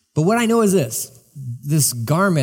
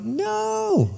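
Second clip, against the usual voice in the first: mock horrified no; 3 dB quieter than usual. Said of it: "and I'm like".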